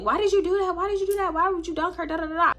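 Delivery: high-pitched mimicked telling-off; imitated scolding. Scolding friend acted out.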